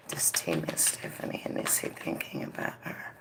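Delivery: soft whispered question to the spirit; whispering. Real, not acted. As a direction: breathe the question out under the breath. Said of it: "whispered question to spirit".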